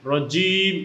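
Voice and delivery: রজিম with a hard "j" and held vowel; strong "j" in a stretched রজিম; firm jim. Shown as a demonstration. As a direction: hit the জ in রজিম firmly. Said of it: "শক্তভাবে উচ্চারণ করব".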